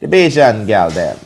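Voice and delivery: put-on a playful, sing-song Bajan accent, done on request. Co-host asked him to do the accent, playful Bajan accent. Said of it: "I'm gonna let [name] do the accent".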